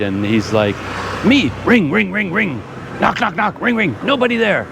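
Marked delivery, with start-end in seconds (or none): 1.2-4.7 higher-pitched mock angry rant, choppy words; imitating an angry driver. Impatient delivery driver to customer on the phone, a high-pitched mock yell. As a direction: bark it out like an impatient delivery man. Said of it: "this very angry, loud voice saying".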